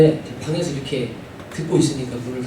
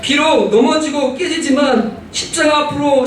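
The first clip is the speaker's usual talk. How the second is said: fervent preaching; 8 semitones higher than usual. Acted. Pastor urging the congregation to cling to the cross, fervently. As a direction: preach it out to the whole church.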